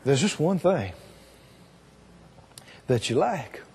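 put-on Jesus speaking tenderly to the young man. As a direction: speak each line gently with love. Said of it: "He says".

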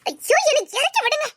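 high pleading ayyo; pleading cry. Acted. Girl carried off wails at the man to put her down. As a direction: wail to be put down.

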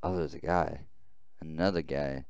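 ordinary talk

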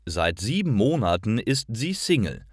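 usual speaking voice

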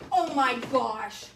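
normal talking voice

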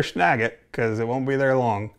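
talking around pipe stem; pipe in mouth. Real, not acted. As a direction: lips clamped on stem. Seen 3: Pipe held to lips, stem in mouth while speaking from 0.6s.

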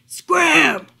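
big angry shout. A narrator's reading. Big dog chasing off the lost dog, shouting. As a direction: shout it as one sharp blast. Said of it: "the big dog just shouted".

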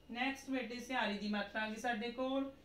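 ordinary talk

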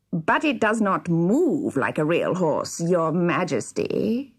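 slow, sly villain voice; evil-grinning dwarf. A narrator's reading. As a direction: draw it out with an evil grin. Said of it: "said the dwarf with an evil grin".

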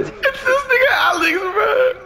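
laughing. Real, not acted. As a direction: blame him through laughter. Laughing blame, high.

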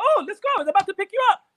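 very high, loud lady's call; German lady. Retold or imitated. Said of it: "She said".

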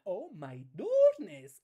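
slow, loud, drawn-out oh my goodness; big mock amazement; amazed exclamation. Real, not acted. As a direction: stretch it out in disbelief. Amazed at the combo.

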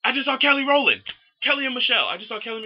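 very high, loud fangirl outburst; excited shrieked news. Retold or imitated. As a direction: blurt the big news in a high yell. Breaks the news to friend, retold.

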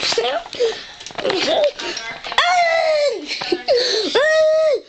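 long high giggling fit. Real, not acted. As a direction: break into helpless giggles. Cracking up at the friend.

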